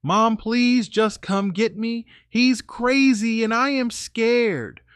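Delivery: high, slow, whiny imitation of her crying to mom; mock scared plea. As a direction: ham up a frightened call for mom. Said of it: "I hear her on the porch screaming".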